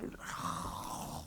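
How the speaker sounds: long, quiet exhale; frustrated sigh. Real, not acted. Breaking off mid-rebuke to critics, frustrated sigh. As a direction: let out a long weary breath.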